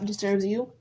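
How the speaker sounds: ordinary talk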